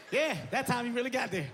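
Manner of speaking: ordinary delivery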